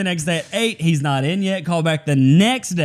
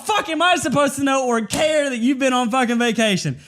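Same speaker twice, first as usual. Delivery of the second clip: higher, shouted mock outrage; laughing mock yell; mock rant; 8 semitones higher than usual. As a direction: yell it in fake fury. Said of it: "And I'm like".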